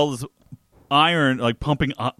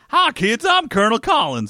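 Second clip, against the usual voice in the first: put-on high-pitched kids-show host voice; cartoon character voice; 11 semitones higher, 4 dB louder than usual. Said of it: "That sounds like a cartoon character".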